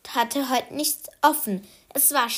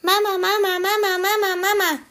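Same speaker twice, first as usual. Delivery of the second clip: put-on high, loud little boy calling his mum over and over; excited child calling; 9 semitones higher, 4 dB louder, faster than usual. Anton calls his mother, high and excited. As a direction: call for Mama like an eager toddler. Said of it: "fragte ganz aufgeregt".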